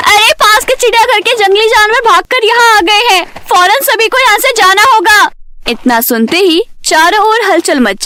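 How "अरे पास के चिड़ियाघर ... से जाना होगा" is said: high, urgent warning to a crowd; loud alarm call. A narrator's reading. Someone warns everyone of escaped zoo animals, loud alarm. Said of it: "बहुत जोरों से एक आवाज आती है".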